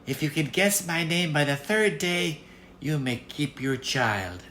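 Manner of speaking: a sly little goblin bargaining; impish little man. Acted. Little man bargains with the girl, high and impish. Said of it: "he said".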